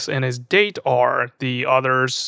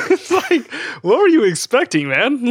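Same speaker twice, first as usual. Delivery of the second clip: laughing; 9 semitones higher than usual. Real, not acted. Laughing jab at Maurice's surprise.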